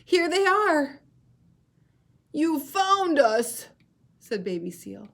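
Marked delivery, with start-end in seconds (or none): 0.0-1.0 high, loud, relieved bear voice; a bear finding the lost pair; Mrs Polar Bear voice. Shown as a demonstration. Teacher voicing a relieved Mrs Polar Bear. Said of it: "show you how to use voices". 2.3-3.7 high, loud, slow seal pup; a rescued seal pup, grateful; relieved Baby Seal. Shown as a demonstration. Teacher voicing a relieved Baby Seal. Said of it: "show you how to use voices".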